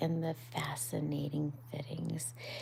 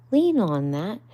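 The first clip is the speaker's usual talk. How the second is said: louder, higher helpful order; Rat helping; 4 semitones higher, 11 dB louder than usual. Acted. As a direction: offer the paw firmly. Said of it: "he said".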